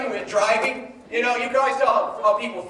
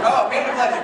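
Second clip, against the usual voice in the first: laughing at herself; laughing mid-sentence; 2 semitones higher, 4 dB louder than usual. Real, not acted. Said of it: "I was so inspired by myself".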